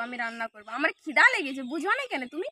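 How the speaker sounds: ordinary delivery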